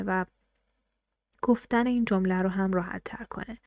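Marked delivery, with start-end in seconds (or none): none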